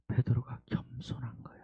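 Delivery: hushed whisper. Real, not acted. Pastor on Peter's humility, whisper. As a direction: let it sink in softly.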